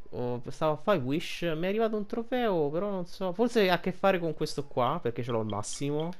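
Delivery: ordinary talk, nothing marked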